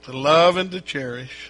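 loud clear vow line; loud vow prompt. Acted. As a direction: call the vow out. Minister prompts again.

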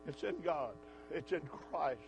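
quiet. Real, not acted. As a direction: drop to a hushed affirmation. Sudden soft after shouting.

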